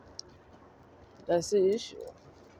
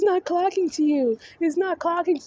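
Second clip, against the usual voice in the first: high, mocking voice, pitched way up; mock Justin; 9 semitones higher than usual. Vlogger parroting Justin in a high mocking voice. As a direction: parrot him in a silly high voice.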